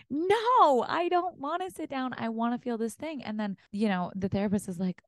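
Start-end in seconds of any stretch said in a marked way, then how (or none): 0.1-3.2 pleading protest. Retold or imitated. Arguing with her own body in therapy, high pleading. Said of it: "And I'm like".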